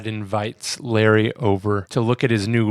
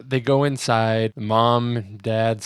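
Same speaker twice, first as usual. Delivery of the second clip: usual speaking voice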